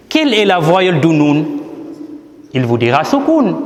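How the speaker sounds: ordinary delivery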